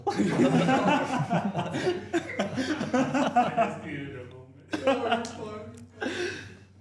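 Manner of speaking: loud, high, real belly laughs; long laughing fit. Real, not acted. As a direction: crack up and keep going. Cracks up after own question.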